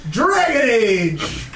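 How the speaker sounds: slow drawn-out announcement; announcer voice. Acted. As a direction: proclaim it like a title. Announces topic dramatically.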